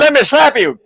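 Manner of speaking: high, loud playful threat; mock threat. Host play-threatens co-host with a slap, hammed-up shout.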